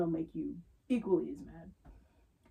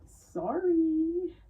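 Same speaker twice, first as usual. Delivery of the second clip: polite sorry; 9 semitones higher, 4 dB louder than usual. Retold or imitated. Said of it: "Just politely say".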